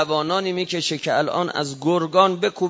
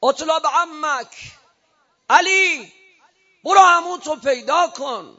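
high, urgent call imitating the Prophet's order; sharp, firm command; 12 semitones higher, 3 dB louder than usual. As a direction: call out a retold command, very firmly, voice pitched high. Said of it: "خیلی تند، خیلی محکم". (نقل فرمانی تند و محکم با صدای بلند و زیر)

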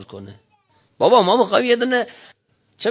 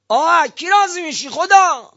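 mock shout to God; 9 semitones higher than usual. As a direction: call out to God in mock exasperation. (فریاد ساختگی رو به خدا)

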